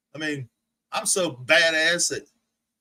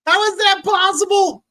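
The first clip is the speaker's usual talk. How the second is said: high, loud incredulous yell; much higher, louder shout of disbelief; disbelieving shout; 13 semitones higher, 8 dB louder than usual. Real, not acted. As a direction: yell it like you can't believe it.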